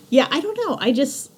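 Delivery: high giggly aside; giggling. Real, not acted.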